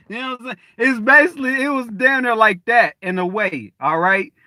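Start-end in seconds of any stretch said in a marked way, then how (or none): none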